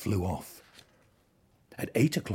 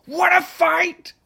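challenging shout; 12 dB louder, slower than usual. A narrator's reading. Ladybird picks a fight.

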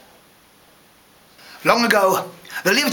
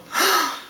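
short, high acted gasp; sharp storybook intake of breath; shocked gasp; 11 semitones higher than usual. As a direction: gasp in alarm. Emperor sees no clothes.

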